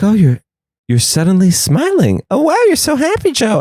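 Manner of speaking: high, gushing coworker voice; high-pitched coworker gushing over his sudden good mood; chirpy coworker. Retold or imitated. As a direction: squeal it like a delighted colleague.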